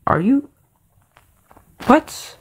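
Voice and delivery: a stunned, rising what; disbelief. Retold or imitated. Kid baffled at his dad's bat plan, high incredulous. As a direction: pitch shooting way up in mock disbelief. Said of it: "I just looked at him like".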